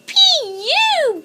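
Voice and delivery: high, loud disgusted cub; high baby bear grossed out; disgusted gag. A narrator's reading. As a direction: recoil from the stink. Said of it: "Baby Bear gagged".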